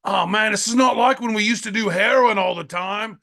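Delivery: a hint of an English lad reminiscing; English accent. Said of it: "the first person that I heard do an English accent".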